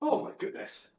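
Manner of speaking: breathless rasp, almost voiceless; ruined voice. Real, not acted. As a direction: voice gone, barely a whisper. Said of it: "My voice is ruined".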